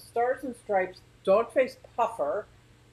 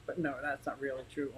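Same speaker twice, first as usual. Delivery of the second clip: softer, hurried words near tears; holding back tears; 4 semitones lower, 10 dB quieter, much faster than usual. Real, not acted. Vlogger fights tears over news she can't share. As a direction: rush it quietly to keep from crying. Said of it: "I'm gonna start crying".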